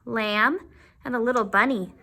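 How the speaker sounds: plain reading voice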